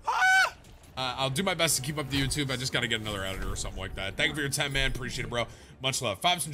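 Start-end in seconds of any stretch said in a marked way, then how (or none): 0.1-0.5 sudden, very high cry; high yelp. Real, not acted. Wordless cry after outburst.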